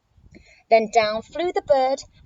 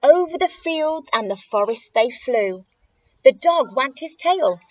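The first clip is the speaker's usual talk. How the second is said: usual speaking voice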